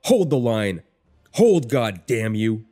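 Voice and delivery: loud, drawn-out command; rally the troops with a yell; shouted battle order. A narrator's reading. Shouted order to wavering soldiers.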